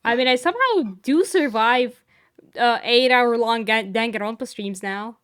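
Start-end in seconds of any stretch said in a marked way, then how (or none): none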